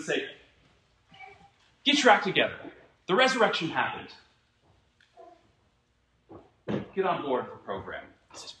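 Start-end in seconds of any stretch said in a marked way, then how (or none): 1.8-8.0 loud, high mock rebuke; mock scolding. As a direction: pretend to tell off the frightened disciples. Jesus not scolding disciples, hypothetical.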